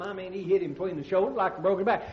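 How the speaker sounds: normal talking voice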